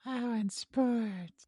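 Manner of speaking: high puppet voice, very slow; sexy Elmo. Pretend. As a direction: draw it out as a seductive puppet. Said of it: "Say it slower".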